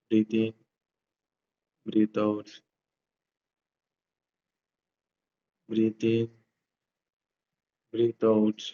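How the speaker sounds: slow, soft breathing guide; soft spa whisper. Acted. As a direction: hush it, guide each breath gently. Leads breathing exercise.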